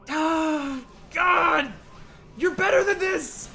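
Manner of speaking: high, strained, pleading outcry; anguished cry. Acted. Viewer pleading with a creator over a bad take, anguished.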